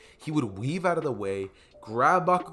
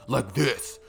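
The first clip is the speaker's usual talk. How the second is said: grown-up voice. Shown as a demonstration. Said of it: "They're not gonna be talking like".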